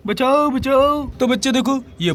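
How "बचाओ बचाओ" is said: mock cry for help. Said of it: "बच्चा रो भी रहा है".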